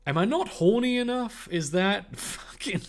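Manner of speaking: normal talking voice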